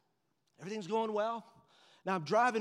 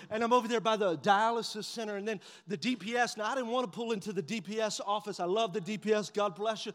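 ordinary delivery

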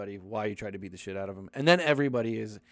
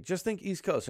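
mock English accent; 8 semitones higher, 4 dB quieter than usual. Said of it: "English people trying to have a Boston accent".

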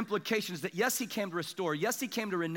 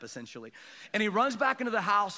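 ordinary talk

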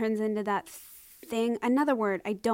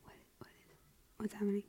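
whispering; 14 dB quieter, much faster than usual. Real, not acted. Amazed at the phone.

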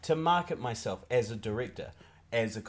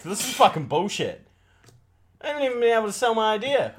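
raised, higher, fed-up complaint; acted indignation; 7 semitones higher, 5 dB louder than usual. Contestant threatening to leave the panel, indignant. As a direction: protest loudly, quickening as you go.

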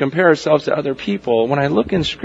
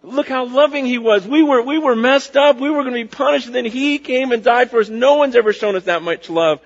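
amazed worshipper; 6 semitones higher than usual. Retold or imitated. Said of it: "because you go".

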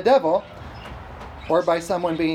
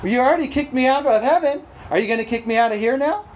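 louder, high-pitched mock indignation; devil's protest; 5 semitones higher, 4 dB louder than usual. Devil confronts Jesus on earth, mock indignant protest. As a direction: protest as a put-upon devil. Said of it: "He's like".

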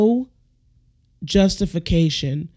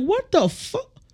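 very high falsetto incredulity; hammed-up outraged bewilderment; acted shocked disbelief; slower than usual. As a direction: squeal it like a stunned driver, without volume. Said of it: "And you're like".

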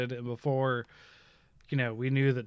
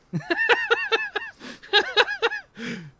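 high-pitched burst of laughter. Real, not acted. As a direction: laugh long and high at a joke. Amused by the chum joke.

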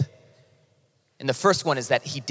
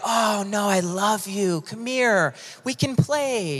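tender parent talk; 5 semitones higher, slower than usual. Acted. Parent coming down to a child, tender coaxing. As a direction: coax a little one sweetly. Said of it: "you got the parents that s-, come down and talk".

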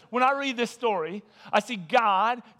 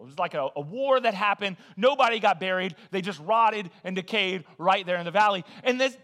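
ordinary talk

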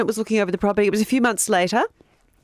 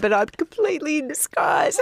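laughing; 9 semitones higher than usual. Real, not acted. Cracking up while telling.

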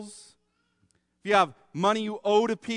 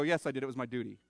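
mock dutiful husband; 7 semitones lower, 9 dB quieter, much faster than usual. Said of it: "I don't look at her and go".

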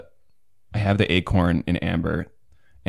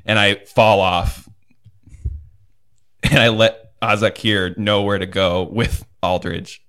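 normal talking voice

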